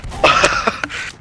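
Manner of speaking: short breathy, voiceless laugh; airy chuckle. Real, not acted. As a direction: let out a quick amused laugh. Laughs at player kiting.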